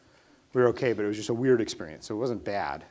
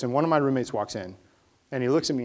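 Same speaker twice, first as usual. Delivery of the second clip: usual speaking voice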